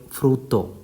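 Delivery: "frutto" as FROOT-toh; oo vowel and held double t in "frutto"; Italian pronunciation. Shown as a demonstration. Teacher giving an example of Italian U, "frutto" as FROOT-toh. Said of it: "Note the pronunciation".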